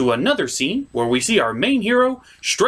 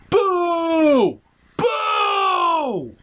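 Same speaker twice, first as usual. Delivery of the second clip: mock booing; 12 semitones higher than usual. Booing the missing opening.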